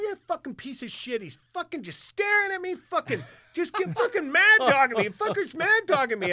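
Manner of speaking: high, loud, worked-up griping; agitated, high-pitched retelling of a tirade; retold angry rant. Retold rant about a starer.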